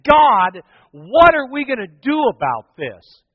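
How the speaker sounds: loud, high, slow angry plea to God; angry prayer. Retold or imitated. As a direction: cry out to God in anger. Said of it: "I was so angry".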